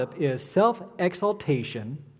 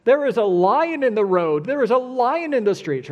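lightly voiced sluggard, higher pitched; mock alarm, making a dramatic excuse; a touch of hammed-up panic; 5 semitones higher, 4 dB louder than usual. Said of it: "the sluggard says".

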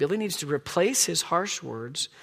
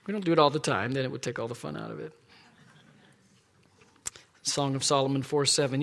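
usual speaking voice

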